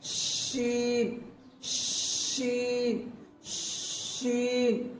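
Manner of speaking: شین with a long, slow hushing sh like shushing someone; شین as a soft sustained shushing sound; drawn-out sheen. Shown as a demonstration. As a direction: stretch the sh of شین slowly. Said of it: "بیچ میں لانا".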